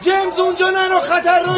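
very high-pitched frantic warning; desperate plea at high pitch; panicked warning. Acted. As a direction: cry out to stop a friend. Warning him away from soldiers.

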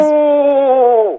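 high, stretched howl; wolf howl. Acted. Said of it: "همین الان باید بگم گرگ".

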